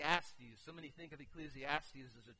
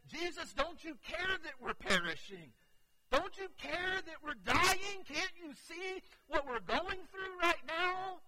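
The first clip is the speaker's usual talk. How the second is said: much higher, louder, slowing anguished plea; pleading cry; 12 semitones higher, 11 dB louder than usual. Retold or imitated. Disciples accusing sleeping Jesus in the storm, anguished plea. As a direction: beg him desperately to wake and care. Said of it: "they said".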